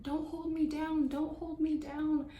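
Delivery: retelling a frantic yell after waking mid-procedure; retold panicked yell. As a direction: plead not to be held down, in a high mock yell. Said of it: "like, yelling".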